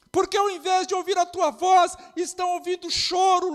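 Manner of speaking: loud, high preacher's shout; shouted repeat. Real, not acted. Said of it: "repeated line shouted".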